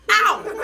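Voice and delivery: sharp loud ow, high; yelp of pain. A narrator's reading. Grandpa bitten by a girl, sharp loud yelp. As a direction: yelp as a finger gets bitten. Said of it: "I said right back".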